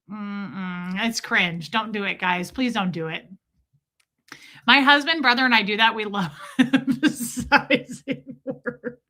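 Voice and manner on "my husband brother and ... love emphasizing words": high laughing read that falls apart; breaking into laughter. Real, not acted. Host reading a viewer's comment, breaking into laughter. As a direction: start loud, dissolve into giggles.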